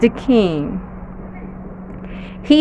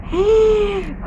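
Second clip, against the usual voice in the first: high, breathy gasp of wonder; awed gasp; 10 semitones higher, 3 dB quieter than usual. Acted. Teacher gasping in awe at the story's ending. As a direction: gasp as if overwhelmed.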